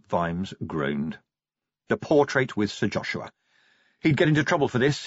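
ordinary talk, nothing marked

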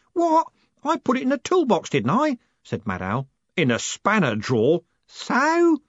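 very high, squeaky indignant voice; indignant Mad Owl. A narrator's reading. Mad Owl answering Alex, high indignant. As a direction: defend yourself, baffled.

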